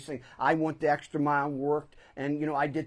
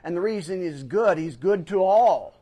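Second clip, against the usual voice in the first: louder, rising preacher's call; preaching; 4 semitones higher, 7 dB louder than usual. Acted. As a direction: lift the congregation with it. Preacher emphasis.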